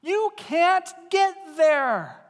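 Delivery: loud, slow, emphatic no; Jesus refusing, loud and slow; firm shout. Retold or imitated. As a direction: declare it like a final verdict. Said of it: "And he says".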